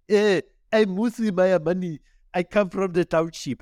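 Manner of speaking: high, loud, exaggerated township-accent impression; Mmusi Maimane. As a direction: lay on a thick, put-on African accent. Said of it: "he's putting on this really thick accent".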